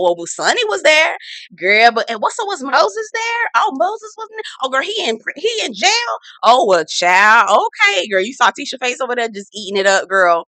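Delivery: put-on high, nosy girlfriend voice; pry with fake innocence; mock gossip. Mimicking castmates gossiping about who was there.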